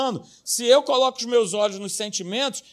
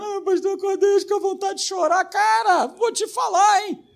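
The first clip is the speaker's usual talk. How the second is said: much higher mocking whine; whiny believer; 10 semitones higher than usual. Believer complaining to the pastor, high mocking whine. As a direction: play the moping believer.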